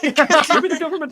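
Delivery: high, loud cartoon yell; shouting. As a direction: bellow the quote back. Echoing the shouted quote.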